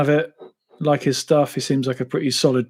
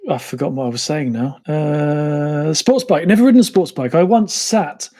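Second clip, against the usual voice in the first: normal talking voice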